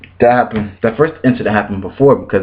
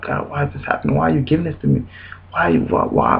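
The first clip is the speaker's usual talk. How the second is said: soft, higher retold plea to God; confused plea; 4 semitones higher, 5 dB quieter than usual. Asking God why she gave her last, retold confused plea. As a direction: question God in hushed bewilderment, as retold. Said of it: "I'm kinda confused".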